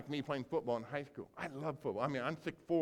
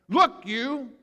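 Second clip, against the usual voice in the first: indignant; 10 semitones higher, 13 dB louder than usual. Retold or imitated. Older son refuses father.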